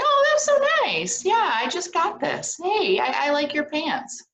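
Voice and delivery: high, bright-eyed gushing thank-you; delighted thanks. Shown as a demonstration. Teacher demonstrating how to thank someone and compliment back, high and delighted. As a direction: gush a little, then flip the compliment back. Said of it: "and I'd say".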